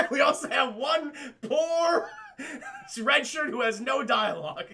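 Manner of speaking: cracking up while naming him; laughing. Real, not acted. Telling chat about the doomed red shirt, laughing through it.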